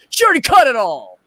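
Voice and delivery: imitating pissed-off complaint. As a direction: holler it in disbelief, lightly acted. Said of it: "Oh, he was pissed".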